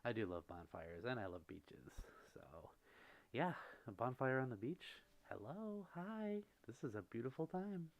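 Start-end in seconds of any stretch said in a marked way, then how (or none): 5.3-7.9 high cutesy voice. Pretend.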